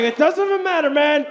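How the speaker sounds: high, breezy put-on voice for the guy; high mock voice of a careless vet; mocking a dismissive old guy. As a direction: brush it off like a know-it-all. Voicing the veteran again.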